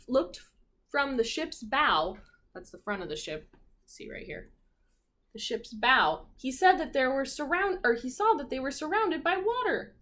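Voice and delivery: usual speaking voice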